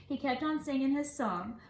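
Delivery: normal talking voice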